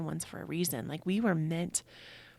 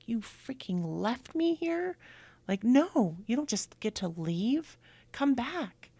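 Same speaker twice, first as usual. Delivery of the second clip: higher-pitched, hurt protest in mock frustration; acted frustrated anger; 6 semitones higher, 3 dB louder than usual. Voicing a friend's bereaved husband, angry and frustrated. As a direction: act out angry pleading, pitch jumping up. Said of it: "anger and frustration if I were him".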